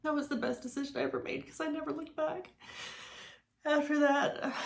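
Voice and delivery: high voice welling with tears; choked up. Real, not acted. Woman recalling the decision to leave, choked up. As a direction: fight back tears as the pitch climbs. Emotional memory of leaving for good.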